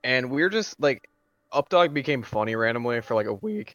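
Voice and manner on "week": voice crack. Real, not acted. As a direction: voice cracking on a word. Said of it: "That was a bad voice crack".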